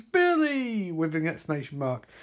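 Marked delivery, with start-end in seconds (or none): 0.0-0.9 scream. Acted. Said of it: "as she screams".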